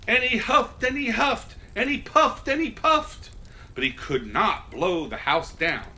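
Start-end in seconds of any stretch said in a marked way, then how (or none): none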